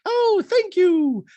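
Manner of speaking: very high, slow sweet mock voice; high mock mother's thanks; Rachel high voice. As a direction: coo it back in a lilting high voice. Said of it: "And Rachel's like".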